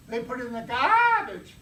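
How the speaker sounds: imitating how Bostonians say garbage; mock Boston accent. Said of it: "They talk funny back there".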